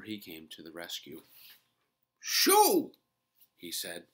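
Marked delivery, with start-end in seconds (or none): 2.2-2.9 loud, high sudden shoo. A narrator's reading. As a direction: shoo them off sharply. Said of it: "loud enough to scare the little mice".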